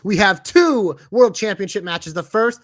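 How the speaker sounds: loud, slow, drawn-out announcer call; booming match-card hype; ring announcer. Pretend. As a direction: announce the title fight with big stretched names.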